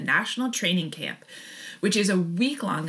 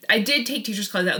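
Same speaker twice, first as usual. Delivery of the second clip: ordinary talk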